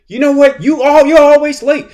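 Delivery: high, loud exasperated outburst at a latecomer; a teacher losing it, voice flying up; mock teacher scolding. Teacher snapping at a late student, high and loud. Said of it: "go off on the student".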